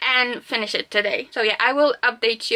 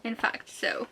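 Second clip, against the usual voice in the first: ordinary talk, nothing marked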